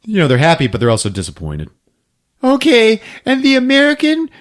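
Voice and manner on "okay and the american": much higher, louder, crying shout of joy; hammed-up tearful shriek; mock ecstatic host. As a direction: scream the win like a thrilled mom. Host announcing own daughter winner.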